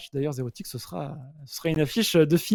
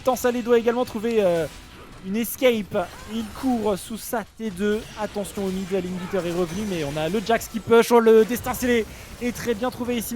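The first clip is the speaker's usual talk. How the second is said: fast, raised live play-by-play; esports caster; 7 semitones higher, 4 dB louder than usual. Real, not acted. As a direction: call the fight as it happens.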